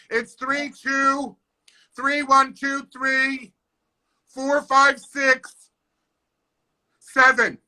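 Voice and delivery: mocking neighbor who breaks up the number all wrong; wrong phone rhythm. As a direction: read "four five six" slowly as one lump, then "seven". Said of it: "it wasn't in the melody".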